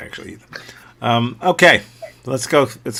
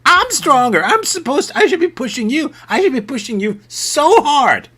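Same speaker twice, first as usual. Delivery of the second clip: put-on squeaky indignant kid protesting; shrill bratty protest, very high; high whiny kid; 13 semitones higher, 3 dB louder than usual. Kid insists he's stronger.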